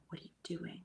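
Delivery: usual speaking voice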